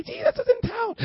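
high, put-on crier's voice; high mock announcing. Demons going out to tell everybody about Jesus, high mock cry. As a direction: pitch it way up like excited gossip. Acting demons spreading the news.

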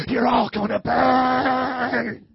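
hugely drawn-out, loud wail on "burn"; hammed-up damnation, stretched into a yell; mock hellfire preacher. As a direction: parody fire-and-brimstone howl. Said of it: "you go to these type of churches".

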